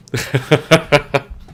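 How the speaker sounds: real laugh at the joke. Real, not acted. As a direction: crack up laughing. Laughing at a chat message.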